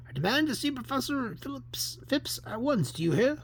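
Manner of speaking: high-pitched, imperious demand; indignant demand. Acted. Visitor demanding to see the inspector, high and indignant. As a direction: insist like an outraged visitor.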